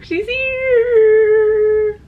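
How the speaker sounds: high, loud squeal of delight; excited squeal. Real, not acted. Vlogger announces to viewers the book has arrived, squealing high. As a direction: squeal like the parcel just landed.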